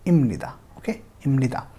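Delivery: इमनिदा with M before N, said again; repeated model pronunciation. Shown as a demonstration. Teacher repeats इमनिदा for students. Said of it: "म की तरह pronounce करेंगे".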